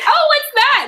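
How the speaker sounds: high, loud, prying question; nosy intruder. Acted. Someone coming up behind the reader, nosy and high-pitched. Acting a nosy person sneaking up.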